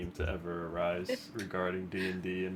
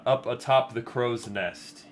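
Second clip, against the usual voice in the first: ordinary delivery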